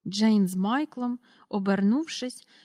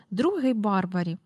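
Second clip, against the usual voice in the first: usual speaking voice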